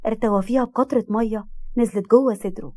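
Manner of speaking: ordinary delivery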